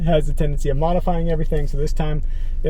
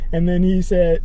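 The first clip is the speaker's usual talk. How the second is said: ordinary talk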